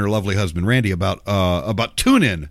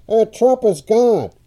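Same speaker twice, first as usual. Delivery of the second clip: higher, slow, tinny AM-radio voice; 10 semitones higher, 4 dB louder, slower than usual. Shown as a demonstration. Host demos how AM radio mangles a caller, slow tinny. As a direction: make it sound like a crackly AM broadcast. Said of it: "it sounds more like this".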